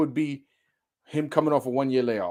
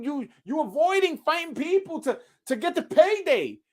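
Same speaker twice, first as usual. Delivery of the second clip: ordinary talk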